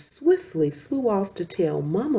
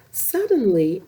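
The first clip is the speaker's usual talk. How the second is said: ordinary delivery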